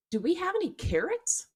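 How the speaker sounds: put-on high, hopeful little boy asking; little son's voice. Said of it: "He goes".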